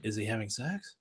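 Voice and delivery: very soft, rising question; hushed wondering. Retold or imitated. Man listening to neighbor's noises, hushed puzzled question. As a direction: murmur it to yourself, puzzled.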